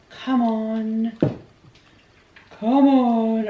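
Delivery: ordinary talk, nothing marked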